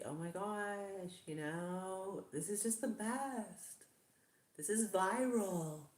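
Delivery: high, slow gushing influencer voice; bubbly fake enthusiasm. Vlogger mocking fake bubbly YouTubers, gushing high voice. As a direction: gush like a phony bubbly vlogger. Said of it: "smile all the time and be like".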